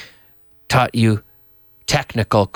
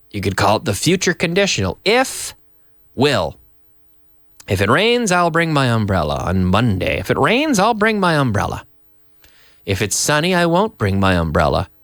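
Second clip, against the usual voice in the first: normal talking voice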